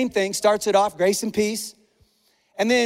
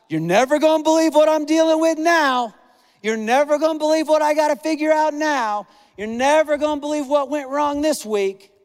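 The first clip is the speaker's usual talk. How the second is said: mock complaining; 6 semitones higher than usual. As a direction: whine it in a raised, dramatic complainer's voice. Mock complaint opposed to grace.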